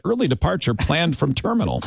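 ordinary delivery

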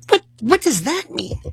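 high baffled squeak. Retold or imitated. Patient baffled by the doctor's odd comfort, high squeak. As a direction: sound utterly bewildered.

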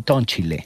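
"toncile" as fake Italian for entonces; mock-Italian "toncile"; invented Italian. Shown as a demonstration. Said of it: "en vez de entonces dicen".

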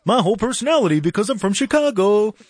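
lightly voiced, hammed-up Chicago guy; mock Chicago native. Host mocking Chicagoans, a light hammed-up Chicago voice. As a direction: poke fun at a proud Chicagoan. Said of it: "the way that you guys would say it".

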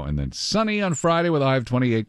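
normal talking voice

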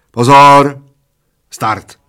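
loud drawn call, then sudden drop; starting command. A narrator's reading. Bald man starts breath-holding contest, commanding. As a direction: shout the warning, then release him.

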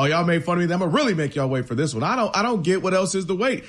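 usual speaking voice

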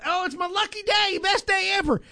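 high, gleeful mock delight; imitating happy coworker. Host mimics coworker showing off dead bat, gleeful and high. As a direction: gush like it's the best day ever. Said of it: "And she was so happy".

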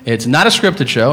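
normal talking voice

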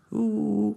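"uh" agudo imitando una ola; ola del mar. Shown as a demonstration. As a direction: haz la sintonía como una ola. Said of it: "como la ola del mar". (silbido vocal de ola, agudo)

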